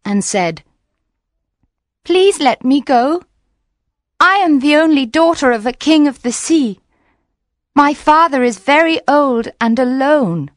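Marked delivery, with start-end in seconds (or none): 2.0-10.5 higher, louder begging voice; frightened mermaid begging for release; pleading mermaid. Acted. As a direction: plead to be let go, near tears. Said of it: "so she began to cry and said".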